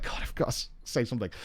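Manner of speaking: breathy, whispered aside to himself; whispered inner thought. Retold or imitated. Yoshi P thinking he must answer the fan, in a whisper. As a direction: mutter it under your breath. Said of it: "was like".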